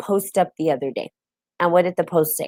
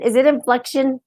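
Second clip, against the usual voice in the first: unsure asking; 7 semitones higher than usual. Real, not acted. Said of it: "I don't know if I'm saying that correctly".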